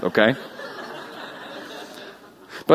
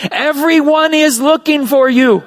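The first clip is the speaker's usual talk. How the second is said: higher, louder urgent exclaim; 11 semitones higher, 5 dB louder than usual. Acted. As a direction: blurt it out eagerly. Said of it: "they exclaimed".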